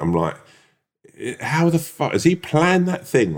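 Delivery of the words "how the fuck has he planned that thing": high, slow, baffled questioning; retold alarmed bewilderment. Diner wondering if stranger planned it, alarmed bewilderment. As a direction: puzzle it out in rising disbelief. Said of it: "heart's racing at this point".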